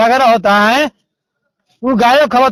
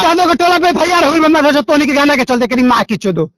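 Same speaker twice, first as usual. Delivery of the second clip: real furious cursing, quick bursts; angry abuse; 2 semitones higher than usual. Real, not acted. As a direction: keep swearing at him in rage. Abusive quarrel on phone call. (गुस्सा में गारी)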